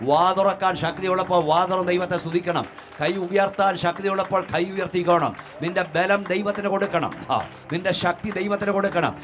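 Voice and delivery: impassioned preaching. Real, not acted. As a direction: build from forceful preaching to a high shouted command. Preaches at mounting full force. (ആവേശത്തോടെ ഉച്ചത്തിലുള്ള പ്രസംഗം)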